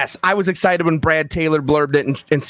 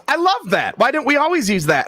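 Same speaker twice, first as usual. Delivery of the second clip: agent's sudden delight; 8 semitones higher than usual. Retold or imitated. Agent suddenly loves title.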